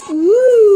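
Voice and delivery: loud, high drawn-out "ooh"; long, loud teasing hoot; scandalised hoot. Acted. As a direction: class jeering at a slip. Class hoots at embarrassing slip.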